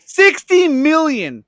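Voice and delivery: slow, high, drawn-out disbelief; amazed echo. Real, not acted. Host hearing the box office figure, slow amazed echo. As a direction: stretch it out in amazement. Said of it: "echoing the box office figure".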